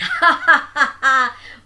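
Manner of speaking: loud, high triumphant cackle; mocking laugh. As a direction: laugh out loud at the tricked guest. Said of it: "But Ilvala laughed".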